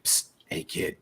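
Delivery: hushed shady dealer whisper; psst for attention. Retold or imitated. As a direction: whisper like a trench coat peddler. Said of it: "he'd be like".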